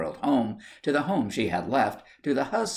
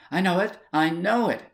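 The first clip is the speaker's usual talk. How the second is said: a mild gasp, higher and a touch louder; 9 semitones higher, 4 dB louder than usual. Acted. Wife dreads hearing the news, mildly gasped higher. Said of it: "gasped Missus Birdwood".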